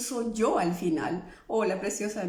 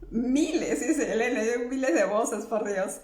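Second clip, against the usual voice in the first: laughing. Real, not acted. Reads comment, laughing.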